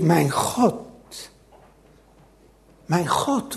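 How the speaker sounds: low, soft, halting prayer; subdued lament. Acted. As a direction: call on God quietly, pausing between calls. Acts the true cry, subdued.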